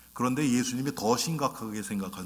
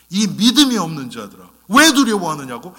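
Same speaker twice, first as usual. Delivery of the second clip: loud rebuke, higher and louder; stern rebuke; 4 semitones higher, 9 dB louder than usual. Retold or imitated. Jesus rebukes his frightened disciples, loud and stern. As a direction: scold them like a master rebuking his followers. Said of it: "책망을 하세요".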